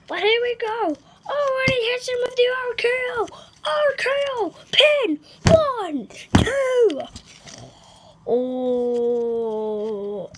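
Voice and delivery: high, loud play-by-play; excited commentary. Pretend. Boy voicing a toy wrestling match as excited commentator. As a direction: call the fight like a thrilled announcer. Kid commentates figure fight.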